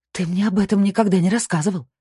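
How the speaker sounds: high, forceful wifely reproach; lashing out. A narrator's reading. Wife attacks husband for keeping secrets, lashing out. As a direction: go at him, indignant and high. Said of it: "накинулась на него Кристина".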